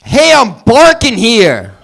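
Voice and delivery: put-on brash New York accent shout; New Yorker impression. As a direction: belt it like a New Yorker on the street. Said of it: "There's a dog from New York".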